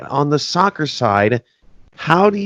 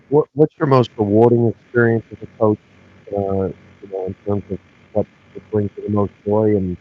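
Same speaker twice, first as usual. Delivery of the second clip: muffled; 4 semitones lower, faster than usual. Real, not acted. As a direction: sound like a bad, muffled line. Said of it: "you're kinda muffled there".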